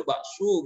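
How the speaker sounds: "bakso" read as bak-suu, long final u; Arabic-spelling reading. Shown as a demonstration. Teacher mocks literal Arabic reading, "bakso" as bak-suu. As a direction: stretch the end of "bakso" into suu. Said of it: "bakso itu bakso kan gitu".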